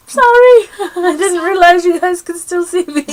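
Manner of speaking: high, loud, giggly apology; sheepish yelp dissolving into giggles; embarrassed laughing apology. Retold or imitated. Caught trying on hat during call.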